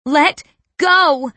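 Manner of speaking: loud, high shouted command; high, forceful yell; desperate shout. A narrator's reading. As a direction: shout it as you fight to free her. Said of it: "I shout at her hair".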